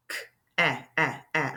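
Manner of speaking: isolating the vowel sound; teacher sounding out a vowel; sounding out the short a. Shown as a demonstration. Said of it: "I hear that short vowel sound again".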